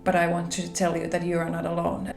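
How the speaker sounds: ordinary talk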